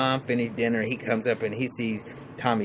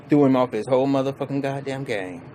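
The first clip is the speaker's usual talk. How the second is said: ordinary delivery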